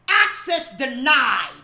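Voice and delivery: slow loud shout of Access denied; preacher's slow loud shout; shouted declaration. Real, not acted. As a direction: bark it out slowly.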